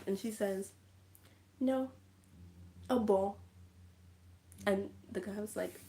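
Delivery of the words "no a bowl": louder, separated words; mock-insistent, each word set apart; firm correction, imitated.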